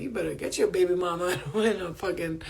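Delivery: ordinary talk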